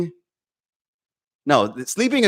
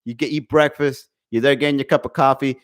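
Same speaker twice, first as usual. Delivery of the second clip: normal talking voice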